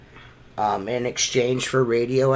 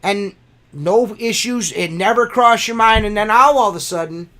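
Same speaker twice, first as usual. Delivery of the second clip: high-pitched, exasperated venting; real worked-up complaint; indignant rant; 7 semitones higher, 4 dB louder than usual. Real, not acted. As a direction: protest your clean record with rising heat. Ranting at gas company over bill.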